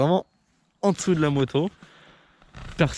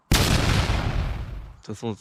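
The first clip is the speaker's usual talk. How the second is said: whispering; 4 semitones lower, much slower than usual. Real, not acted. Teasing aside to viewer about Christmas.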